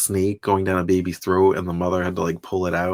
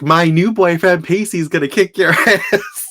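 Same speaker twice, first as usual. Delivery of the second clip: high, loud, slow, laughing through the threat; hammed-up unhinged girlfriend boast; crazy lady threatening; 9 semitones higher, 6 dB louder, slower than usual. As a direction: taunt the ex with her new man. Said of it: "I was laughing, though, 'cause she was like".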